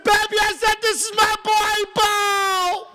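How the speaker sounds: high, loud re-enacted excitement; excited announcement. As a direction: call it out high, stretching the last word. Excited announcement to his wife.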